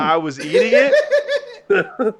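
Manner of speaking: laughing through the words, slow and high; breaking into laughter mid-story. Real, not acted. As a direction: crack up while saying it. Laughing while recounting spicy food.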